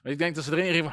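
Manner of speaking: usual speaking voice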